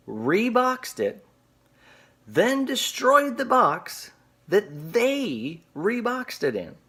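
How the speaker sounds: high, very drawn-out disbelief; retold incredulity. Seller to eBay supervisor about FedEx reboxing, incredulous. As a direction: spell it out slowly and high, as if absurd.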